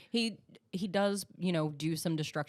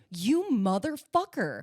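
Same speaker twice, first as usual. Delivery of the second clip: slow, loud, drawn-out accusation; mock outraged disbelief; 3 semitones higher, 5 dB louder than usual. Host retells watching the betrayal first time, mock outrage. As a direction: stretch each word in indignant disbelief.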